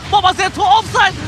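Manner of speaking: excited call. Real, not acted. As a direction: hype up the word offside. Same call, hyped.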